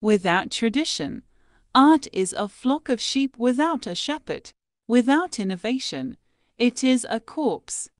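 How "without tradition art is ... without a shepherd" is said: ordinary delivery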